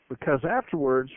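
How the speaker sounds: ordinary delivery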